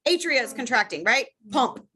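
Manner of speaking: usual speaking voice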